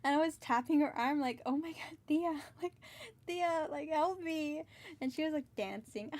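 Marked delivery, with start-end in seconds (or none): 1.4-4.6 panicked plea. Retold or imitated. Grabbed by stranger, pleading aunt.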